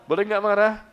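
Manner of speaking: teasing loud question. Shown as a demonstration.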